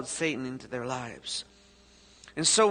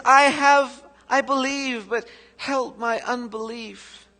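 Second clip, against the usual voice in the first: desperate plea; 5 semitones higher, 5 dB louder, slower than usual. Acted. Father's plea read again.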